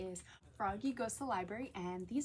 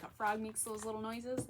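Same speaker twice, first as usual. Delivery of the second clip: ordinary delivery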